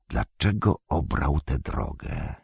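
low, slow, softened question; hushed musing. A narrator's reading. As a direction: wonder aloud to yourself, slowly and softly. Gandalf musing after whisper. (cicho, powoli, jakby do siebie)

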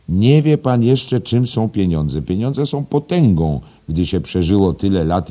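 high, loud, emphatic preaching on money; lecturing schoolmaster. Acted. Headmaster lecturing young teacher about money, loud and emphatic. Voices Mr Deasy in story.

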